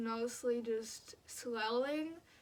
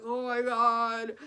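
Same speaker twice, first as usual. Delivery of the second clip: distressed; 6 dB louder, slower than usual. Retold or imitated. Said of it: "So then I started crying".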